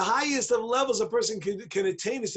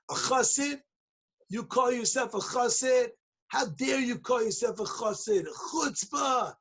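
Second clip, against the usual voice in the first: higher-pitched mock indignation; acted outrage, pitched up; indignant challenge; 4 semitones higher than usual. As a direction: confront him as if scandalized. Litvaks indignantly confront the chassid.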